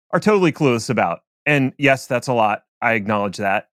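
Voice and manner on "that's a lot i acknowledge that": normal talking voice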